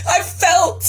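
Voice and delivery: real tears breaking through; crying. Real, not acted. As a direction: let the tears break in as you say it. Reliving the moment of forgiveness.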